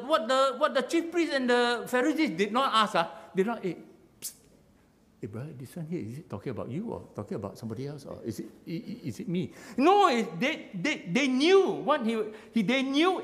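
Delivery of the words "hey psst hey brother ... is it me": mock conspiratorial whisper. Conspiratorial aside among priests.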